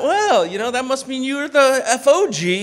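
high, loud retort for laughs; cheeky comeback. Retold or imitated. New guy answering the old-timer's jab, loud and cheeky. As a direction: fire back at full voice, playfully. Retold comeback to veteran colleague.

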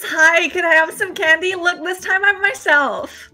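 put-on high, loud child voice; childlike begging. Player pretending to be a child asking the goddess, high begging voice. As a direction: plead like a kid after sweets.

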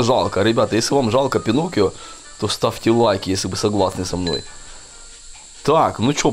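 ordinary talk, nothing marked

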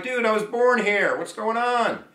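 high-pitched acted bewilderment; indignant protest. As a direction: protest in disbelief at being suspected. Voices indignant wrongly suspected citizen.

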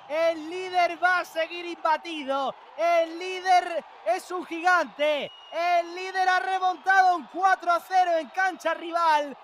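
excited. Real, not acted. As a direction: marvel at the comeback. Post-goal excited commentary.

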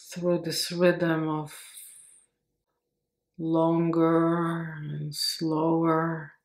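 slow meditation voice, long pauses between phrases; guided meditation. Acted. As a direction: stretch the words out calmly to slow the breath.

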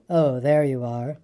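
low, very slow gloomy donkey; slow, disapproving low grumble; grumbling. A narrator's reading. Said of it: "in his melancholy way".

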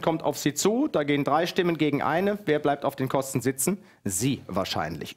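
ordinary talk, nothing marked

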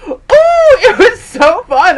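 slow, high, loud and laughing; thrilled. Real, not acted. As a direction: relish the memory with a laugh. Reliving the race thrill.

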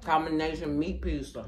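talking with food in mouth; muffled chewing speech; chewing while talking. Real, not acted. Seen 2: Pursed, working mouth after a bite while holding the slice.

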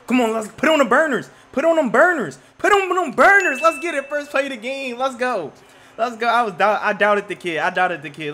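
hyped-up, high-pitched shouting; loud, high hype, easing off towards the end; excited shouting. Real, not acted. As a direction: yell in celebration at a huge play. Celebrating first play score in game.

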